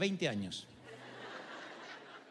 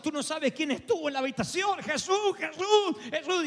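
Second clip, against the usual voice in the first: excited cry; 9 semitones higher than usual. Retold or imitated. Husband bursting in to tell his wife, excited. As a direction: burst out with the name in wonder.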